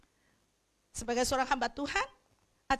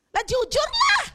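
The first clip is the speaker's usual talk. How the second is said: high shouted challenge; 8 dB louder, slower than usual. Real, not acted. Preacher challenging the crowd.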